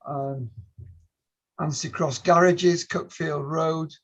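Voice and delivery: usual speaking voice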